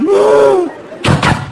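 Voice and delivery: sharp high gasp; high startled gasp; mock gasp. Son sick in bathroom.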